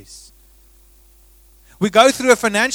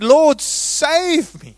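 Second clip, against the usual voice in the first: crying out; 5 semitones higher, 5 dB louder, much slower than usual. Acted. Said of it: "he cried out and he said".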